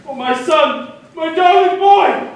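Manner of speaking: anguished cry. Acted. Ajax cries out for his son.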